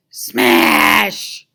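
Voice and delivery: shouted sound effect; loud smash. A narrator's reading. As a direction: bark the crash like a sound effect. Sound effect of guitar destroyed.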